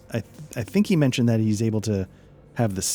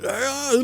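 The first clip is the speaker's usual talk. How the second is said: short high pained yelp; a quick strangled cry, much higher; mock cry of pain; 4 dB quieter than usual. The GM voicing Gurton buried under bodies, high yelp of pain. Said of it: "crying out in pain".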